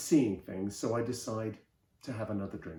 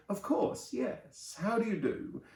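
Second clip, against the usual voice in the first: high, airy society greeting; gushing bluff; 8 semitones higher, 3 dB quieter than usual. A narrator's reading. Film people greeting a supposed celebrity, high gushing bluff. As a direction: pretend to know them, pitched right up.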